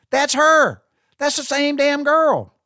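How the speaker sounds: high, loud, amazed; excited reveal. Real, not acted. To his listeners, amazed at the casting reveal, high and loud. Reveals actress was Fran Drescher.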